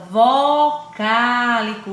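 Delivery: "vocálico" loud and slow, "vo" stretched apart; syllable by syllable. Shown as a demonstration. Teacher showing the word comes from vogal, "vocálico" syllable by syllable. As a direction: sound out "vocálico" in slow beats. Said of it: "já diz".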